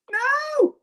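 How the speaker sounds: a high laughing "No!" of dismay; shocked cry. Real, not acted. Vlogger squinting at lipstick label, high shocked cry. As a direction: squeal it in disbelief.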